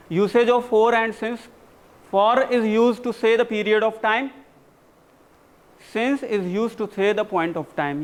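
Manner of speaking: very slow, spelled-out teacher rule; slow classroom dictation. Shown as a demonstration. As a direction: dictate it word by word for notes. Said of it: "teacher dictating rule slowly".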